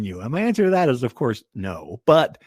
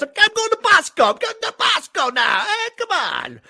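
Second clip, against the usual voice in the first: put-on much higher, loud Jersey mobster accent; Paulie Walnuts voice; 5 dB louder than usual. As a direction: ham up a Jersey wiseguy. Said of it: "I was trying to do Paulie Walnuts".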